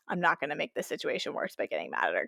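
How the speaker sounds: hushed self-talk; calm inner thought. Retold or imitated. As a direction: say it to yourself, keeping your cool. Calm inner resolve during mishap.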